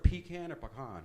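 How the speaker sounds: Pecan first as PEE-can then as puh-KAHN; two pecans. Shown as a demonstration. Comic asking the Texas crowd which way to say it, PEE-can then puh-KAHN. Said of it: "How do you guys say this word?".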